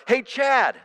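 high mock holler; imitated kid's yell. Second grader yelling to someone in the school hallway, imitated yell. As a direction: call out like a young pupil yelling. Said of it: "second graders yelling out".

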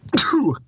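a real sneeze; sharp sudden sneeze; sneezing. Real, not acted. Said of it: "sorry, I'm gonna sneeze real quick".

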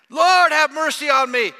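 very high, loud plea; desperate cry. Acted. Blind man crying over the crowd to Jesus, desperate loud plea.

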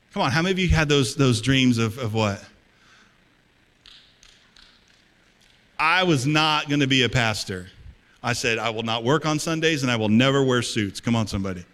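loud, rousing preacher's call; shouting pastor rallying his church; full-force preaching. Acted. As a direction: stir up the crowd with big sermon volume.

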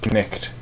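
Knicht with a spoken K and guttural ch; fake old pronunciation. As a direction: say Knicht as k-nicht. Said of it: "night was actually pronounced".

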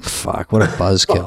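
low, slow, soft disappointed grumble; deadpan buzzkill gripe. Retold or imitated. Guest at the star photo shoot told no drinks, low slow letdown grumble. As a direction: drag it out, let down. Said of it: "And I'm like".

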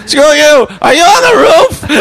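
high, incredulous mock yell; retold shouting. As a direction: imitate hollering up at someone on a roof. Acted shouting match with neighbor.

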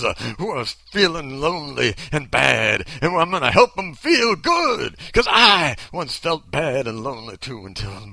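hammed-up revival preacher, building to a shout; televangelist preacher. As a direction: preach it like a TV evangelist working the crowd. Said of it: "I can't talk like this".

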